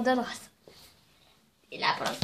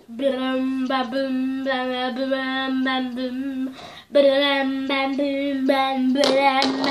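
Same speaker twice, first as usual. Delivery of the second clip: mock sound effects; much slower than usual. Sound effects while cutting.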